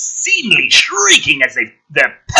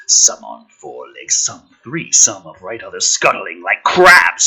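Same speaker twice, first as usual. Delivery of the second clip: low ominous narration; 8 semitones lower than usual. Acted. Reading the father's arrival from the script, low narration. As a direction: murmur the scene with menace. Reads screenplay action aloud.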